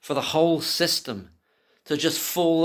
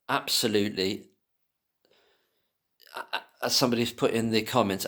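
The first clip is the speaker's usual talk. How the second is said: normal talking voice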